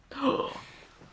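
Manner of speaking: sharp, voiceless intake of breath; shocked gasp. Real, not acted. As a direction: gasp in scandalised surprise. Shocked reaction to the film.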